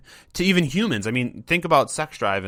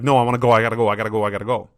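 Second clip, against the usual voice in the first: lightly voiced frantic dog, louder at the start; anxious dog's inner voice; 6 dB louder, much faster than usual. Owner voicing a stressed dog desperate to leave, lightly frantic. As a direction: plead to leave like a nervous dog pulling away. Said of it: "they're like".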